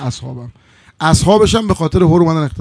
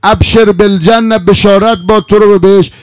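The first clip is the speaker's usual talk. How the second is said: loud, high, stretched-out proclamation; heavenly voice; 3 semitones higher, 8 dB louder than usual. Acted. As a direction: call out glad tidings from on high. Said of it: "یه بار دیگه این صدا رو شنید".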